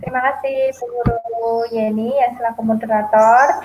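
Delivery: ordinary delivery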